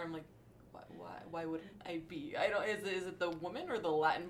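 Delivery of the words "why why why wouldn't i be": soft, drawn-out stammered comeback; slow, hushed puzzlement at the question; baffled retort. Retold or imitated. Answering people surprised that she is there, baffled.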